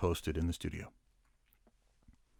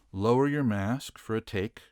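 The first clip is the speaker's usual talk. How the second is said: ordinary delivery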